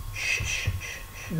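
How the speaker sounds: breathy whispered shush, trailing off. Real, not acted. Vlogger shushing herself while brushing eyebrows, whispered. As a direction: hush yourself gently while working.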